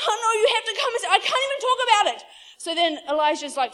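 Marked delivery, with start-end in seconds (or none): 0.1-2.2 fast, high, loud mock panic; freaking out. Servant too upset to explain to Elijah, frantic. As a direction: gabble it like a hysterical servant. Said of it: "freaks out, comes running back in".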